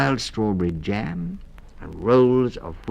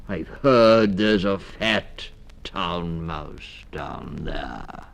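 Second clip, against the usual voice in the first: menacing owl; 4 dB louder, much slower than usual. A narrator's reading. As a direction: savour the thought of prey. Hunting owl speaks slowly.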